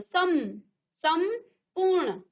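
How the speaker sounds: सम then संपूर्ण, drawn out syllable by syllable; split syllables. Shown as a demonstration. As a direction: say सम apart, then join into संपूर्ण slowly. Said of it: "आधा नौ का उच्चारण आ रहा है".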